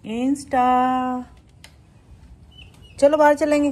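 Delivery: loud, high-pitched call to a pet. Real, not acted. Vlogger asking her pet to go out, loud excited calling.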